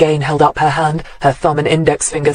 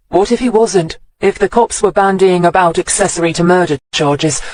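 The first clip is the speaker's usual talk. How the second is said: ordinary delivery, nothing marked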